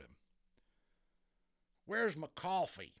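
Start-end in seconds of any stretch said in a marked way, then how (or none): 1.8-2.9 high, loud, slow whiny demand; a grumpy cowboy wanting his coffee; complaining cowboy. A narrator's reading. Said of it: "he complained".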